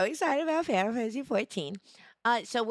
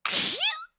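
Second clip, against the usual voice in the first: high squeaky laugh. Real, not acted. After near sneeze.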